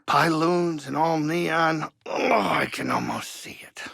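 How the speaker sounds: slow, dreamy voice drifting into a whisper; picture the neon sign and savour it; dreamy wistful. Acted. Pilot daydreams to Cal about his cantina, dreamy slow whisper.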